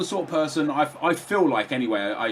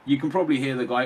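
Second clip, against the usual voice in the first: usual speaking voice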